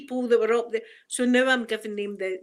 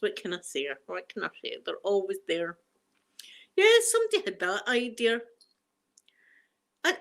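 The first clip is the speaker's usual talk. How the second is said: ordinary talk, nothing marked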